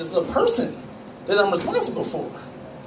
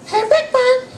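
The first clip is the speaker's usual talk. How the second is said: much higher, slow singsong puppet voice; Elmo voice; 11 semitones higher than usual. Retold or imitated. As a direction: chirp it like a sweet little furry monster. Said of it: "Elmo looked up at Big Bird and was like".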